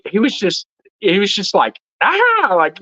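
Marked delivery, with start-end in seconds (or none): 2.0-2.5 high mock yell at normal volume; imitating a scared man's random yelp; imitated yell. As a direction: let out a high, restrained "ah". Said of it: "he was just, like, randomly yelling".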